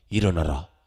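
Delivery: low, loud command to rise. Acted. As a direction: call him up from death, deep. Jesus raises the young man.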